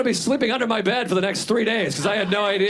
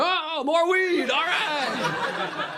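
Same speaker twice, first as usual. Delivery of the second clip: high, slow and dazed, laughing through it; stoned mock delight; 11 semitones higher, much slower than usual. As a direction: play it buzzed and delighted. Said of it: "you're like".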